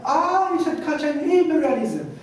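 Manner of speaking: high, loud "Ah" of dawning understanding; sudden realisation. Retold or imitated. London librarian finally understanding the request, sudden realisation. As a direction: imitate someone finally catching on.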